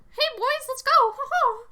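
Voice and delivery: very high, loud cartoon mouse squeak; Mickey Mouse voice. Shown as a demonstration. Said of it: "give her a Mickey Mouse voice".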